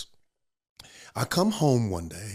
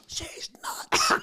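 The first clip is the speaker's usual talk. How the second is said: whispered aside. Retold or imitated. Husband mutters aside.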